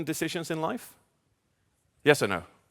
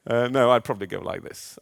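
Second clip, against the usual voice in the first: normal talking voice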